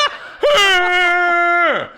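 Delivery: long, high howling mock laugh; imitated deaf laugh. Deaf fans in a Chicago restaurant laugh, loud howl. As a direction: howl it out like a roaring fan. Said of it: "They're like".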